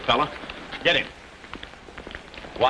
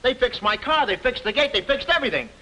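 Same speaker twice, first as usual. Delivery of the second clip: higher-pitched, insistent explaining; agitated protest; 6 semitones higher than usual. Acted. Man to doubting police about the frame-up, agitated protest. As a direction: plead your case as nobody believes you.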